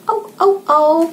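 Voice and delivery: high-pitched little "au" barks; imitated dog bark. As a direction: mimic a dog in short repeated yaps. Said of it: "suara anjing gimana". (menirukan gonggongan anjing bernada tinggi)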